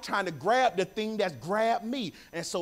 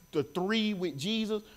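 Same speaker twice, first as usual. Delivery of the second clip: ordinary delivery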